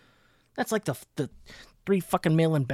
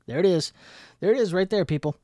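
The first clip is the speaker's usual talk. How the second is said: mock triumph; 5 semitones higher, 5 dB louder, faster than usual. Sarcastic triumphant reveal.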